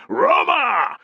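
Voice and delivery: high, loud chanted Roma; a roaring army cheer. Acted. Whole army cheering Roma, high and loud. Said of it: "the cheer was rolling off".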